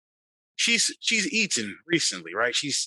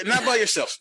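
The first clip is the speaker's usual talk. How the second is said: urgent insistence; 8 semitones higher than usual. Real, not acted. Player insists, repeated.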